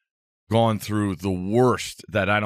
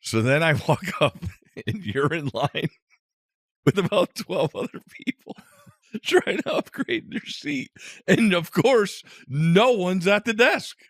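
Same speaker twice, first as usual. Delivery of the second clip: high-pitched, helpless laugh-talk; real cracking up, voice climbing high; laughing hard; 9 semitones higher than usual. Real, not acted. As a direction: squeeze the words out through laughter. Cracking up as he tells it.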